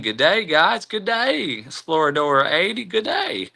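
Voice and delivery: high, sing-song mock Aussie greeting; bad Australian accent. Streamer greeting a viewer in chat, bad Australian accent. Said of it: "that was a bad accent".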